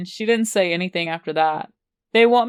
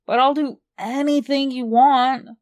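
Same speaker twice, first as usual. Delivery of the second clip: put-on higher, pleading like a child; whiny baby voice; 5 semitones higher than usual. A narrator's reading. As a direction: beg like a little kid. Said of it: "I sound like a fucking baby".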